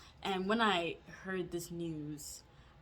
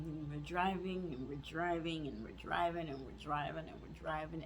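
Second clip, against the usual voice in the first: low monotone getting softer and softer; droning repetition, fading; 3 semitones lower, 6 dB quieter than usual. Retold or imitated. Storyteller showing how long the drive dragged, droning and fading. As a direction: let each repeat trail lower, as if endless.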